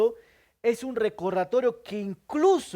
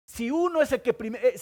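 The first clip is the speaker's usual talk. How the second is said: ordinary talk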